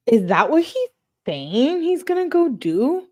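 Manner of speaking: high-pitched, incredulous question; shocked realization. Real, not acted. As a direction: voice shoots up as it dawns on you. Realizes what the slang means.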